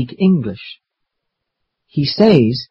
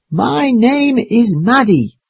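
slow, higher-pitched, drawn-out words; slow and loud; 9 semitones higher than usual. Acted. Maddie answers grandfather's request, slowly and loudly. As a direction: say it slowly and high for a hard-of-understanding listener. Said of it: "He asks me to speak slowly and loudly".

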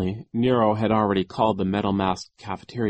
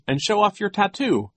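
high, loud playful announcement; pretend joking; 12 semitones higher, 4 dB louder than usual. A narrator's reading. Klaus grabs at Genghis's feet, pretending to joke. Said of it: "as if he were still joking".

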